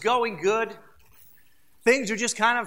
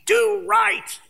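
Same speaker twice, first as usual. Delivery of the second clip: loud, high, sharp order; barking command; 12 semitones higher, 8 dB louder, much slower than usual. Pretend. Preacher voicing a harsh God snapping at Cain, high and loud. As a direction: jump at him and bark it. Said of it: "He doesn't jump at him and goes".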